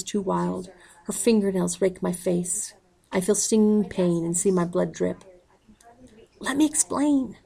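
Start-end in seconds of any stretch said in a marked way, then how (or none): 6.4-7.4 pleading shout, pitched well up. A narrator's reading. Narrator shouts to the raging mother, high pleading call. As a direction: cry out over her screaming. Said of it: "I shout".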